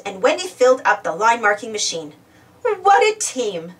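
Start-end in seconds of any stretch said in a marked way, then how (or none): 2.6-3.8 slow, higher proud praise. A narrator's reading. Wendy praising the machines, proudly. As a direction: savour the teamwork. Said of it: "said Wendy proudly".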